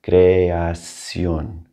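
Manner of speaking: creación drawn out slowly for a learner; slow, stretched Spanish creación. Shown as a demonstration. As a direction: say creación at half speed, syllable by syllable. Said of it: "You can slow these down at first".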